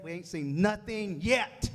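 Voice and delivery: slow and punchy, rising at the end on yet; slow rising rally to the crowd; slow, drawn-out emphasis. Real, not acted. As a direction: stretch it out to rally the room. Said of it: "repeated rallying line, slowed and drawn out".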